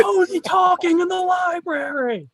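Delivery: high, slow indignant yell; hammed-up scandalised shout; mock outraged shout. Mock outraged librarian complaint.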